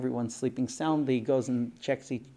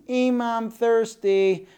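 put-on high, slow, drawn-out kid voice; whiny thirsty child; 8 semitones higher, 5 dB louder, slower than usual. Small child waking mother for a drink, whining. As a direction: call to mother in a loud, stretched child's whine. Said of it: "The sibling gets up and says".

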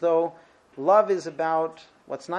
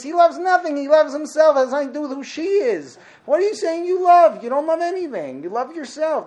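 high, loud indignant rant; heated lecturer's mock outrage; indignant outburst; 8 semitones higher, 6 dB louder than usual. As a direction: explode at the selfish lover. Ranting against the love song.

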